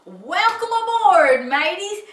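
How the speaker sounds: hearty loud captain's greeting; shouting. A narrator's reading. Captain welcoming rescued friend aboard, hearty shout. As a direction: boom out a sailor's welcome. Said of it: "shouted as he slapped his friend".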